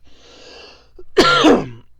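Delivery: hoarse, loud throat clear. Real, not acted. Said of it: "like, right there".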